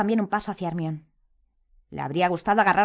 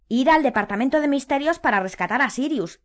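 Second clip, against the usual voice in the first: higher, louder voice; raised voice; 8 semitones higher, 6 dB louder than usual. A narrator's reading. Harry tells his friends the plan, in a raised voice. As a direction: say it out loud, pitched up. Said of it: "dijo Harry en voz alta".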